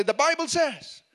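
high-pitched, insistent declaration; firm declaration. Shown as a demonstration. Preacher models answering temptation in modern language, insistent declaration. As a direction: declare it as a ready comeback.

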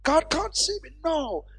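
high, urgent pulpit delivery; impassioned, stretched key words; fervent preaching. Real, not acted. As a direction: build to a ringing proclamation. Preaches at full force.

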